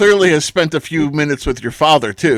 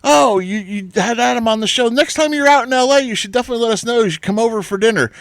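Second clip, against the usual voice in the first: higher, friendly imitation of the wife; imitating the wife; 9 semitones higher than usual. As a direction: chirp out the invitation like the cheery wife. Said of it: "your wife says to me".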